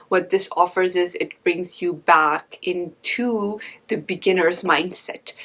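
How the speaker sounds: normal talking voice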